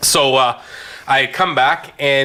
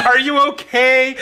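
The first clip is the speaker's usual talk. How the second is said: concerned; 10 semitones higher than usual. Retold or imitated.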